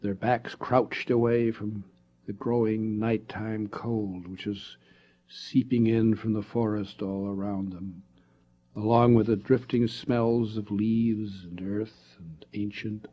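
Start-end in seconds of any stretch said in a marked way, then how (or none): none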